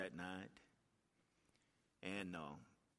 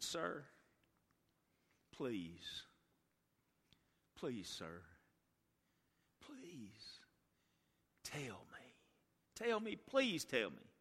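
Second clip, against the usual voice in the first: begging mother; much slower than usual. Acted. Grieving mother begs pastor.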